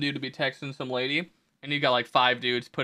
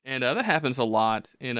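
ordinary talk

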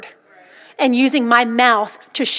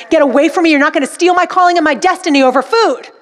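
raised, defiant pretend rebuke; forceful, higher-pitched send-off to a pretend foe; talking to a spirit; 3 semitones higher, 4 dB louder than usual. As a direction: order the imagined spirit off. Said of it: "as if I was talking to a spirit".

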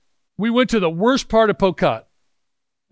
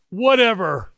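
a raised, offhand wave-away; dismissive; 5 semitones higher, slower than usual. Real, not acted. Preacher to atheists in the crowd, lightly dismissive. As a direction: brush the doubt aside lightly.

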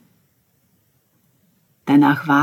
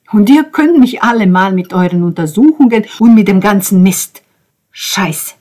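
loud, fuming tirade; raised voice, sweeping dismissal; angry rant; 4 semitones higher, 9 dB louder than usual. A narrator's reading. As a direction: lash out at everyone, loudly. Ben revives and rants, cursing.

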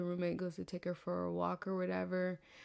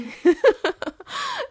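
shrieking burst of laughter; loud high laugh; 11 dB louder than usual. Real, not acted. Cracking up at her own boss bitch line, loud shrieking laugh.

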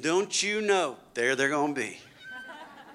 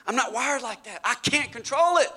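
higher, pleading excuse voice; whiny objecting churchgoer; 8 semitones higher, 3 dB louder than usual. Retold or imitated. Churchgoer objecting to the pastor, whiny and higher. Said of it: "You say".

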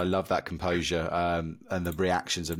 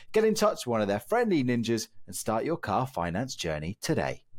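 upbeat, higher ad read; bright sponsor read voice; 5 semitones higher than usual. Acted. Reads sponsor ad.

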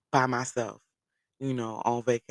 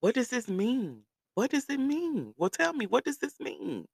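put-on little girl voice; 10 semitones higher than usual. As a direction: pester like a curious kid. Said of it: "the little girl, she just kept saying".

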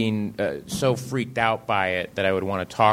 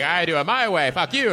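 rising, higher hammed-up defiance; mock defiance; 6 semitones higher than usual. Writer mocking his own defiance at critics. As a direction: fling it back, much higher. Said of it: "And you're like".

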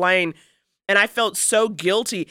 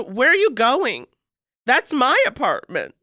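higher, slow retold confrontation; indignant challenge; 6 semitones higher, slower than usual. Retelling her challenge to a stranger on the stairs, indignant. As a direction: challenge him, drawing out the words in a raised pitch.

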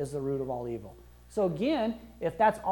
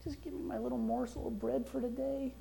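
much softer, higher pleading voice; begging prayer; 6 semitones higher, 8 dB quieter than usual. Retold or imitated. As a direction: beg pitifully like a beggar. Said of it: "We don't have to beg the Father".

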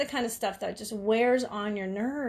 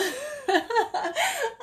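high laugh; 13 semitones higher than usual. Real, not acted. Laughing at her mom's outburst.

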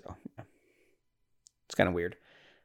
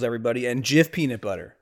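usual speaking voice

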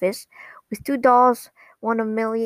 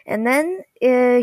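ordinary talk

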